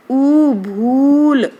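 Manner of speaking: teacher drawing out ऊ slowly; long ऊ demo. Shown as a demonstration. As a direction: hold the ऊ in भूल. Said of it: "तो देखो".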